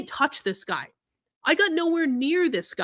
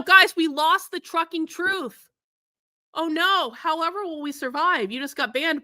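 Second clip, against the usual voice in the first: higher-pitched fake lament; hammed-up, insincere distress; sarcastic mock dismay; 4 semitones higher than usual. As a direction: pretend to mourn a banned troll. Mocks banned commenter's departure.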